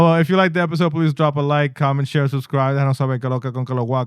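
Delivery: host sign-off read; podcast outro. Acted. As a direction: wrap up like a host.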